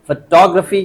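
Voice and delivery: "Photography" as fuh-TOG-ruh-fee; stress demo. Shown as a demonstration. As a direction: lean on टो in "photography", reduce the rest. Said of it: "क्या बोलेंगे इसको?". ("Photography" में टो पर ज़ोर)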